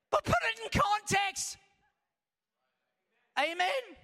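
high, shouted exhortation; preacher shout. Acted. Preacher calling the church to perspective, shouting.

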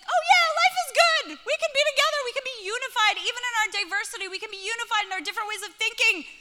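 high, exuberant celebration; loud squeal easing into fast, high cheer; elated. Acted. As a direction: gush with over-the-top joy. Joy of coming upstairs, acted.

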